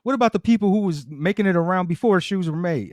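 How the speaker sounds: high-pitched mock quarrel; acted ranting. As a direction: rant back at a griping partner, hammed up. Acting out a couple's quarrel.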